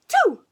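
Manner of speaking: big loud high mock sneeze; a hammed-up sneeze that throws him off. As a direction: explode into a sneeze. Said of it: "coughed, gagged, and sneezed".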